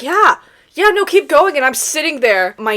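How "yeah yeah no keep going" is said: high, loud, over-eager yeah; mock-reassure them through the tickling; strained reassurance. Ticklish client to manicurist, strained high reassurance. Ticklish, pretending she is fine.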